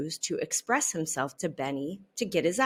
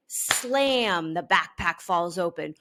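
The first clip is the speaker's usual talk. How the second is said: ordinary delivery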